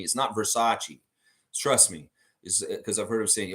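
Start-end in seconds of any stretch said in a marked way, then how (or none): 0.3-1.0 Versace said ver-SAH-chee; fashion-house pronunciation. Shown as a demonstration. Host ruling out the style company's name, mispronounced. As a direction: say Versace like the clothing brand, ending in chee. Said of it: "the clothing company or the style company".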